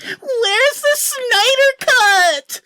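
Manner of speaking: very high, very slow, loud despairing cry; anguished mock wail. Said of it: "banging my head against the wall, and said".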